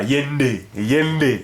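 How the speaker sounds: Yende with the nd blended, Lingala style. Shown as a demonstration. Teacher giving a Lingala example, Yende said with a nasal nd. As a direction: say Yende, the n gliding straight into d. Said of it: "Exemple, Lingala".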